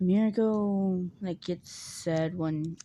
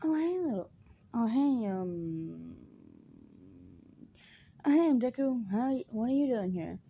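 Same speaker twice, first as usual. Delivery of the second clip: fake nice; 4 semitones higher than usual. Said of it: "Acting all nice".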